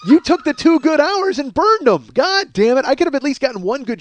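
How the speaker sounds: frustrated blast at the co-host; exasperated outburst. Real, not acted. Blaming co-host for broken headphones.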